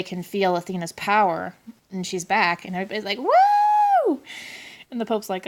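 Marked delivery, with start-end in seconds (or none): 3.2-4.2 long, high-pitched "woo"; sustained imitation cheer, pitched way up; mock crowd cheer. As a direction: whoop loudly like a cheering crowd.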